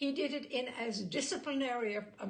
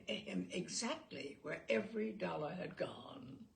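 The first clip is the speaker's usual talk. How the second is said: ordinary delivery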